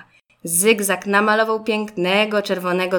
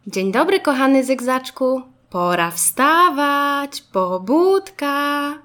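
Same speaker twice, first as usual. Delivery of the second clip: higher, drawn-out singsong wake-up; mother's wake-up call; 6 semitones higher, 4 dB louder, slower than usual. A narrator's reading. Mama waking little Zygzak for preschool, singsong wake-up call. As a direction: stretch the words out to rouse a sleepy child.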